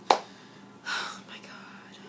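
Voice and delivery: slow, emotional whisper; hushed and moved. Real, not acted. Emotional over [name]'s mom.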